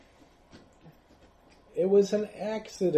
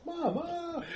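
high, softer wail; whimpering mama; 12 semitones higher, 5 dB quieter than usual. Acted. Dragged villager cries for mama, softer and scared.

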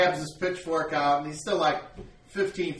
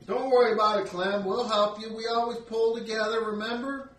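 high voice, friendly comfort; consoling villager; 8 semitones higher, 5 dB louder than usual. Acted. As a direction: reassure Clem kindly. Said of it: "Another guy's like".

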